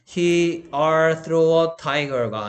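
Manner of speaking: extremely slow reading of the wrong sentence; very slow wrong example. Shown as a demonstration. Teacher reading the wrong example to the class, very slow. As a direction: drag out the wrong version. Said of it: "아니고".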